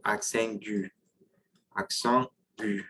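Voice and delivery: aigu said the French way, nasal first vowel in accent; accent aigu, French pronunciation. Shown as a demonstration. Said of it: "That is gonna be called".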